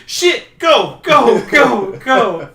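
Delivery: loud, high urgent calls to run; frantic hurry. Retold or imitated. Han yelling at Chewie to flee, loud panicked calls. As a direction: hustle them out, panicking. Escaping the Nexu, urgent calling.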